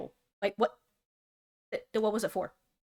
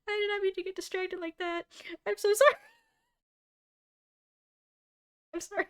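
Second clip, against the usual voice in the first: high-pitched apology through helpless real laughter; giggling too hard to get the sorry out; laughing apology; 10 semitones higher, faster than usual. Real, not acted. Streamer apologizing to chat while cracking up, laughing. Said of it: "hurt my chest when I laughed".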